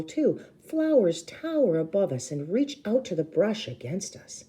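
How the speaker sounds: ordinary delivery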